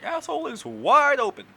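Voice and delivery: loud, pitched-way-up lewd girl impression; crude high mock voice. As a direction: ham up a vulgar party girl.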